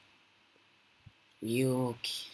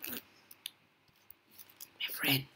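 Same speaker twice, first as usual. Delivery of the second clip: hushed sneaking whisper; 9 dB quieter than usual. Real, not acted. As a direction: whisper it so nobody hears. Sneaking near the beast.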